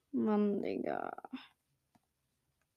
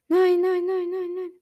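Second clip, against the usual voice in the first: loud, high repeated no; desperate shout; 7 semitones higher, 5 dB louder than usual. Real, not acted. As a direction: yell in panic. Watching a goal go in.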